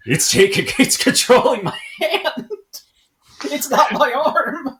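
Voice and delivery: high, slow, half-laughing panicked squeal; mock panic. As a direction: play a man horrified his hand moves on its own, cracking up. Man panics over his own hand.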